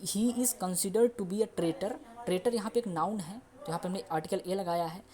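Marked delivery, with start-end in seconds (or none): 0.1-1.9 very slow English example; slow model sentence. Shown as a demonstration. Teacher modelling the considered sentence. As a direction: say it slowly for learners. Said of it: "present indefinite का हुआ".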